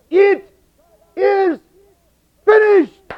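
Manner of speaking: high, slow, shouted, one word at a time; cried out. Acted. Preacher voicing Jesus on the cross, crying out. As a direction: cry each word out from the cross. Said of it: "he cried out".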